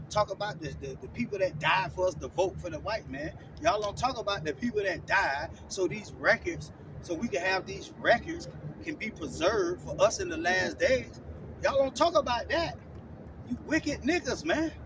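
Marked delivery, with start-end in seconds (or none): none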